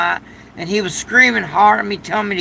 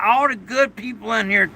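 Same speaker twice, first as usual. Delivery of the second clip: ordinary delivery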